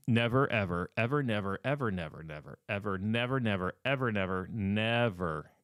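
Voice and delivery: hammered repeated never ever; never, ever said again and again with weight; emphatic never ever. Real, not acted. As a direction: drill the warning in word by word.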